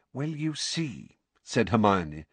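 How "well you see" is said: slow, soft, patient explaining. A narrator's reading. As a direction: talk him through it like a toddler. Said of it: "with the patient air of someone explaining".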